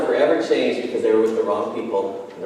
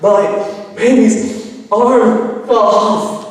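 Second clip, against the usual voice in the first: put-on high, slow, louder sobbing child's voice; little girl sobbing; 8 semitones higher, 7 dB louder, much slower than usual. Little girl tells the man about her broken doll, high slow sobbing wail. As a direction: drag it out like a heartbroken little girl crying over her toy. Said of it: "through her sobs, you know, how they talk".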